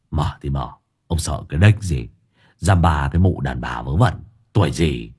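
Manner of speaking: low, bravado-filled grumble; low male character voice. A narrator's reading. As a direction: talk yourself into courage. Hải Nghẹo bracing himself. (giọng trầm, lấy can đảm)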